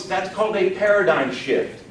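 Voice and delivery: ordinary talk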